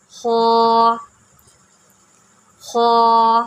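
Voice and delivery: خو said full and heavy from the throat. Shown as a demonstration. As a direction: make خو thick and rounded. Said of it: "موٹا کر کے پڑھا جاتا ہے".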